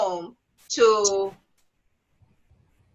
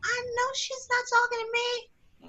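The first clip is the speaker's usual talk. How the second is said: incredulous inner voice; 12 semitones higher than usual. Retold or imitated. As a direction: act out an offended thought, high and hushed. Her disbelieving thought while being called.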